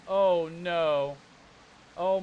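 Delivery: normal talking voice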